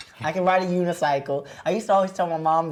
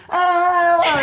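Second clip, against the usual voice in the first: short laughing yelp, high and loud; a cartoon cry for a fall; sound effect yell; 13 semitones higher, 8 dB louder than usual. Shown as a demonstration. Voice actor shouting out a falling bear's yelp. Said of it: "you have to go like".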